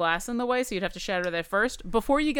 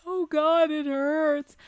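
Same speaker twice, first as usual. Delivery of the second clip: mock pain; 8 semitones higher, 4 dB louder, slower than usual. GM voices Bernie's pain.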